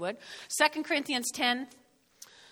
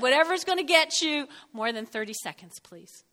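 usual speaking voice

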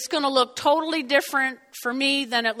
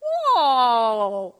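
amazed whoa; 6 dB louder than usual. Retold or imitated. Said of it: "I'm like".

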